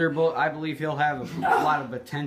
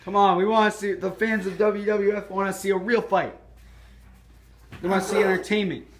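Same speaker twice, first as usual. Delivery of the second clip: lively ringside play-by-play; wrestling commentator; 5 semitones higher, 3 dB louder than usual. Acted. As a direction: call the match like a TV announcer.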